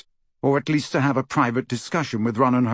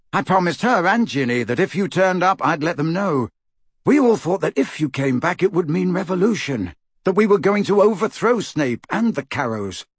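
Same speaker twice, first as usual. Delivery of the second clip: higher-pitched, keen explanation; keen rebel, pitched up; eager Neville; 6 semitones higher than usual. Acted. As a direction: explain the plan with rising hope.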